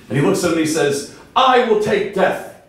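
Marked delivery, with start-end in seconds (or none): 1.3-2.6 higher, slow and firm, a resigned choice; condemned man's answer. Acted. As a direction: say it slowly like a man choosing death.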